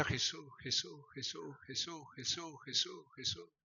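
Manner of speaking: hushed desperate prayer; urgent whispered plea. Shown as a demonstration. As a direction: plead the name under your breath.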